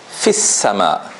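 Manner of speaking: "fis sama" with short i into doubled s; slow "fis sama", short vowel, long s; ya dropped. Shown as a demonstration. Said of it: "So we, we read it".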